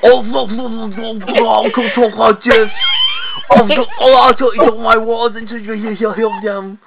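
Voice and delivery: mock Scottish accent, high and garbled; hammed-up Scottish gabble, words swallowed; David Tennant. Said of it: "he sounds like he's swallowing".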